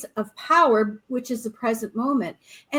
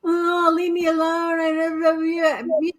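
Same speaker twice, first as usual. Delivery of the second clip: groggy grumbling; 3 semitones higher than usual. Retold or imitated. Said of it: "how many times do people".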